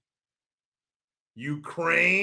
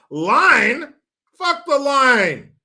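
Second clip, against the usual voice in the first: indignant mock retort; 6 semitones higher, 6 dB louder, much slower than usual. Mock outrage at the line.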